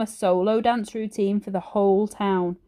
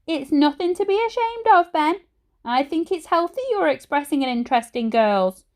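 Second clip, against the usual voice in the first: a higher, coaxing mum voice; Mum's voice; 7 semitones higher than usual. A narrator's reading. Mother to embarrassed son, higher, coaxing. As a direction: reassure your son in a motherly voice.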